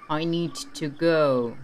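put-on slow, low British-accented English; a touch of a terse Englishman; slow mild British accent. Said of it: "Er hatte einen britischen Akzent".

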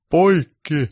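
director's sharp call; cut shout. Acted. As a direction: yell it to stop the camera. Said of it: "herra Baltasar huusi".

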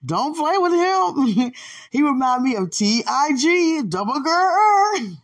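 high, loud and slow with laughter; hyped. Real, not acted. Praising the man who can jump, high and loud. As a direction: hype him up, almost crying with laughter. Excited hyping about the jumper.

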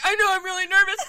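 high, loud mock fluster with laughter; nervous, near tears. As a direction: blurt it in a squeaky wail. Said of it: "And then I start crying".

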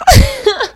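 high burst of laughter; real loud laugh. Real, not acted. Laugh after punchline.

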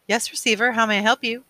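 high, bright-pitched attendant; obliging speaker voice. A narrator's reading. Attendant answering the receiver over wall speaker, obliging high voice. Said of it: "the voice said".

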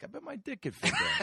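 mock eager kid. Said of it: "you were like".

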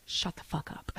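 very soft, voiceless whisper; acted-out secret warning under the breath. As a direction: whisper it so only he hears. Repeats the private warning to Joe.

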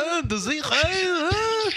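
high, swooping mock accent; Zohan. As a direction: join in the silly accent parody. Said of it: "he sounds like Zohan".

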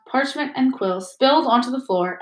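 plain reading voice

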